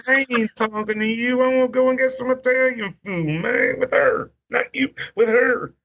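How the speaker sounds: very high, squawking imitation of annoyance; Slick. Wrestler brushing off the buddy, high mock-annoyed voice. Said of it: "And he's like".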